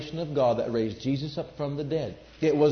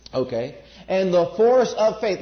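ordinary delivery

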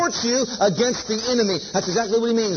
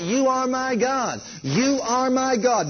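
slow, deliberate declaration; slow confession; 4 semitones higher, slower than usual. Shown as a demonstration. Preacher models a slow confession for the congregation. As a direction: say it slowly, owning each word. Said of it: "We need to hear ourselves say that".

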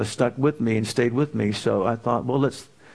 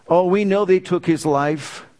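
ordinary talk, nothing marked

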